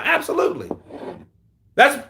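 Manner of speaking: ordinary talk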